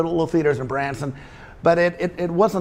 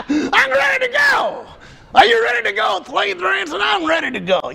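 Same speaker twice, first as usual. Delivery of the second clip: squeaky ventriloquist dummy; 4 dB louder than usual. Acted. Dummy calling out excitedly.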